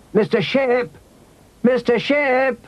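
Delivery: put-on high, sustained child's call. A narrator's reading. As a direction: call for someone across a yard. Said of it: "And Rosie called too".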